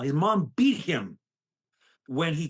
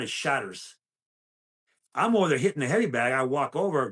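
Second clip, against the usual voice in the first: ordinary talk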